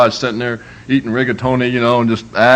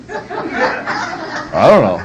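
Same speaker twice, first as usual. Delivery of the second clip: "I don't know" stretched slowly through a laugh; laughing drawn-out I don't know; 6 semitones higher, much slower than usual. Shown as a demonstration. Preacher teaching fathers, drawn out with a laugh. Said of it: "to say".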